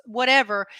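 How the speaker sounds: ordinary delivery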